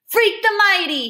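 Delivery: high, slow, loud proclamation; drawn-out victory call; triumphant cry. A narrator's reading. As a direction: crow it like a hero's title. Freak's triumphant cry as he's carried off.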